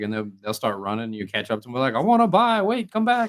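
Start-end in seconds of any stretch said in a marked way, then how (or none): 2.0-3.3 calling after. Pretend. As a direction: yell after a fleeing dealer. Calling after fleeing dealer.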